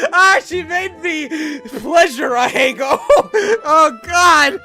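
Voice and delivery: real helpless laughter, high and loud; real laughing fit; laughing hard. Real, not acted. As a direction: break down laughing mid-read. Cracking up at the line.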